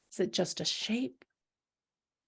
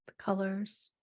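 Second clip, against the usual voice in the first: whispering; 8 dB quieter than usual. Real, not acted. Whispered prompt.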